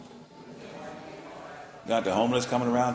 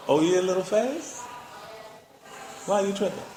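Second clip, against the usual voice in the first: teasing rebuke; 8 semitones higher, 3 dB quieter than usual. Acted. Jesus rebuking, mock.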